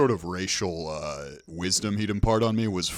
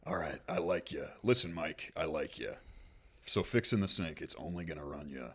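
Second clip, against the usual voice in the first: low, soft, chummy con-man voice; cheap uncle; 5 semitones lower, 7 dB quieter than usual. Retold or imitated. Friend imitating a cheap uncle quoting a sink repair, low and soft. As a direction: lean in like a shady uncle. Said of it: "your cheap uncle perceives the world".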